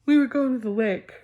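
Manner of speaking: high, loud outburst; startled loud exclamation; sudden loud burst. Acted. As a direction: blurt it out suddenly.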